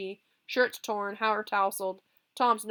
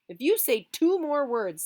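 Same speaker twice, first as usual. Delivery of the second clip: threatening; 7 semitones higher, 8 dB louder than usual. Acted. Threat before fight.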